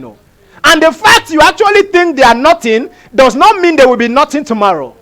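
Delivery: high-pitched, hard-hit preaching; forceful preaching. Real, not acted. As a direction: drive each point home to the congregation.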